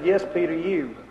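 soft, low and certain; quiet answer. Retold or imitated. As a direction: drop right down for a knowing reply. Jesus calmly answers Peter's boast.

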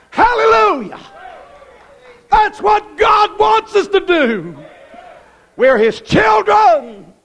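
high, slow, ringing holler; full-force preacher's cry; ecstatic preaching shout. Real, not acted. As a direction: throw it out to the congregation.

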